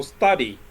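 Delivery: "study" stressed on STU-, falling; first-syllable stress. Shown as a demonstration. Teacher showing an irregular verb, "study" stressed on the first syllable. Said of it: "the stress is on the first syllable".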